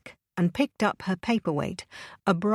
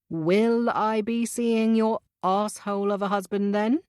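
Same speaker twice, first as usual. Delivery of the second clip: teasing, coaxing drawl pitched a touch up; persuasive drawl; 4 semitones higher than usual. A narrator's reading. Boss needling Layla about her husband, persuasive drawl. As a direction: coax her with a sly drawl. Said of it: "he said in a persuasive drawl".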